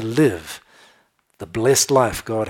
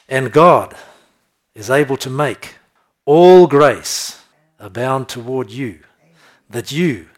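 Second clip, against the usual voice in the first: loud, slow, weighty reading of the verse; forceful scripture reading; 12 dB louder, slower than usual. Acted. Preacher proclaiming the verse to his church, loud and slow. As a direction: proclaim the promise to the congregation.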